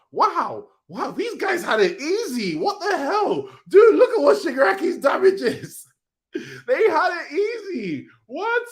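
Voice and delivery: very high, laughing shocked outburst; stunned disbelief. Real, not acted. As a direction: squeal in amazed disbelief. Shocked at Shigaraki's damage.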